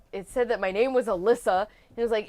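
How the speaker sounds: ordinary delivery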